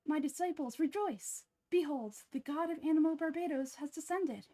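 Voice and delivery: grand proclamation, descended said right; fixed second take of the god reveal; clean retake. Acted. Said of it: "Let me try that line again".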